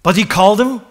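high, loud preaching build; fiery preaching. Acted. Preacher driving the story home to the congregation, fiery. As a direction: preach it out to the church.

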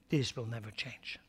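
whispered promise. Acted. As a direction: let it fall to a breath. Sermon, whispered after loud line.

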